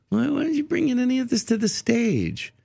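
imitating a puzzled fellow comic, pitched high; high-pitched quoted question. Quoting a comedian's puzzled question.

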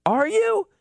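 high, disbelieving question; a retold incredulous are you. Doubting the kid's joke.

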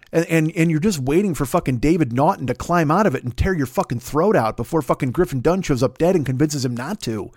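ordinary talk

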